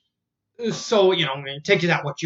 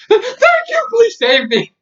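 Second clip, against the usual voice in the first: higher, slow, loud pleading in a put-on voice; desperate old lady; 7 dB louder than usual. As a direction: plead tearfully to be rescued. Kidnapped lady begs to be rescued.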